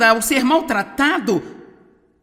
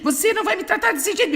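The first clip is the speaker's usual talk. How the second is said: high, rapid comic nagging; mock quarrelling; 8 semitones higher, faster than usual. Spouse yelling back in a pointless fight, mock-angry and high. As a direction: rattle it off in a pitched-up parody of a row. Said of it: "se alguém está gritando com você".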